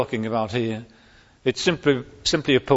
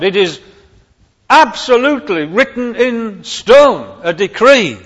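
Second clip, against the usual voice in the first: higher, louder preaching on "decree"; preaching at full force; 7 semitones higher, 6 dB louder than usual. Acted. Preacher to congregation on God's decree, preaching at full force. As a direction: drive home that it can't be altered.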